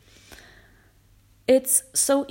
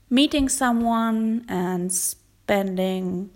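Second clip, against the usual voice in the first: ordinary talk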